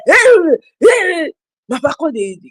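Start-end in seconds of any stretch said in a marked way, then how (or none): 0.0-1.3 chasing shout. Retold or imitated. Shouting during the chase.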